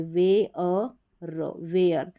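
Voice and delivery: वे, अ, र said apart, very slowly, then वेयर joined; syllable split. Shown as a demonstration. Teacher sounding out wear slowly for students. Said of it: "वेयर करके पढ़ेंगे".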